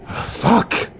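high, bursting curse; frustrated yell. A narrator's reading. Narrator as sirens close in, frustrated yell. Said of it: "I yelled".

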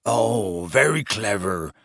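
low, gruff growl; sour, low growl. A narrator's reading. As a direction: grumble it sarcastically. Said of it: "growled Hagrid".

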